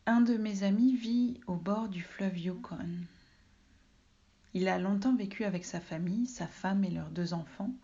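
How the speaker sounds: literary reading aloud. A narrator's reading. Reads translated text aloud. (lecture littéraire posée, à voix haute)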